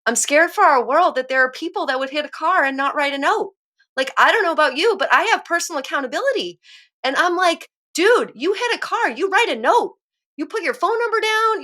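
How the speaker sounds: getting worked up, pitch climbing high; real upset rising into a high, strained plea; emotional, getting choked up. Real, not acted. Speaker moved about hit-and-run drivers, emotional and high-pitched. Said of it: "this one gets emotional".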